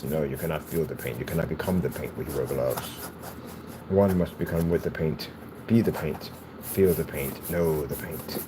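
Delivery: low, soft, solemn painting master; hushed mock-wise pronouncement; mock guru. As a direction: intone it quietly like a mystical art teacher. Mock guru answering the glove question.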